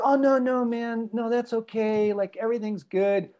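imitating a friend pretending all is fine; fake reassurance. Retelling a friend who insists a missed meeting is okay, in fake breezy reassurance. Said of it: "But I could tell he was pissed".

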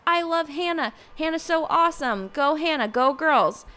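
lightly put-on high cheer; mock fangirling. Vlogger mimicking friends who love Hannah, mock fangirling.

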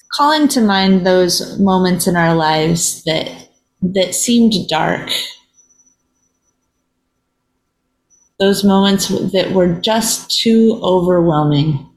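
unhurried meditation voice with long silences; guided reflection. Real, not acted. Host leading listeners in a moment of pause, meditative. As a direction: lead gently, leaving long pauses between phrases.

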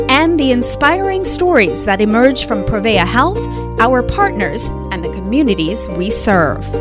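polished, lively show announcer over music; podcast intro read. Acted.

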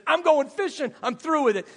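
shouted high and loud, fed up; angry outburst. Retold or imitated. Preacher voicing Peter quitting on Jesus, shouting. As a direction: slam the door on it all.

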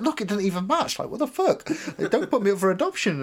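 high, loud frantic pleading; hammed-up desperate protest; retold tearful panic. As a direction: beg like a wrongly accused child. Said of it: "I start crying".

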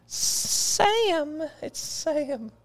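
very high falsetto, not shouted; hammed-up high squeal; fan squeal.

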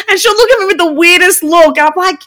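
high laugh spilling into words. Real, not acted. Laughing about her daughter.

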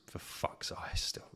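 whispered under the breath, exasperated; a fed-up whispered curse. Real, not acted. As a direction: hiss it out in frustration. Exasperated aside about the podcast.

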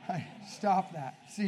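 normal talking voice

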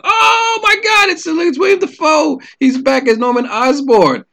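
shouted high with excitement, then settling; high, stretched fanboy shout of joy; retold excitement. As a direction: gasp it out like a thrilled fan seeing his hero return. Retold excitement at Dafoe's return.

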